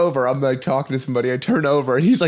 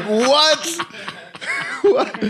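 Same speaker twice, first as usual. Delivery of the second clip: very high, squeaky-pitched disbelief; hammed-up astonished "what"; incredulous; 12 semitones higher than usual. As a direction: act out total bafflement, breaking into laughter. Retells disbelief at brother's story.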